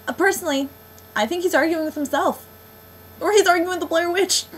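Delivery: loud, high-pitched mock theorizing; exasperated rant. Real, not acted. Reviewer joking that Josh argues even alone, exasperated. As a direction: push it up high, fed up with the bickering. Ranting joke about endless arguing.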